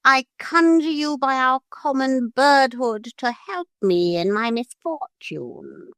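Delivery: high, pleading parrot voice; earnest plea. A narrator's reading. Parrot begs the gulls by common birdhood, earnest and high. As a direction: beg a fellow bird solemnly. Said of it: "said the parrot earnestly".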